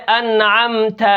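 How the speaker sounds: teacher modelling انعمت clearly; recitation demo. Shown as a demonstration. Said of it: "اسی طرح کیا ہے".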